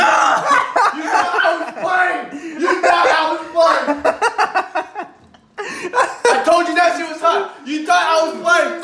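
laughing while yelling it out; triumphant laughing yell; laughing shout. Real, not acted.

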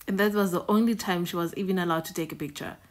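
ordinary talk

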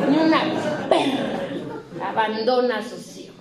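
grandmother scolding with heat. Retold or imitated. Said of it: "le echaba calor así".

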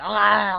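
imitating the monster's shout, loud; mock monster yell. Mocking the monster's shout, loud and sharp. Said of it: "bağırmaya gerek yok".

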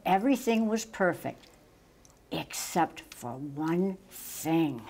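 ordinary delivery

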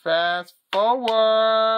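high, slow, loud announcer call; wrestling announcer. Pretend. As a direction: drag out the move name like a ring announcer. Announcing the finishing move.

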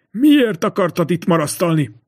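ordinary delivery

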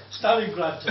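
ordinary talk, nothing marked

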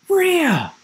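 high-pitched exclamation of surprise; astonished cry. A narrator's reading. Boy spotting someone in the wood, astonished. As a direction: call the name in amazement. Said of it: "he said in astonishment".

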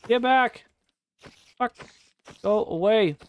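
panicked shouting. Real, not acted. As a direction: shout the mob away in a panic. Fighting spider.

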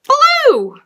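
ordinary delivery

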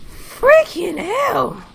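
drawn-out outraged shout; disgusted yell. Real, not acted. Disgusting bean, outburst.

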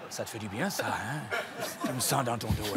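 very low, soft seductive purr; sultry low seducer. Acted. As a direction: play the smooth tantra seducer. Seducer teasing player.

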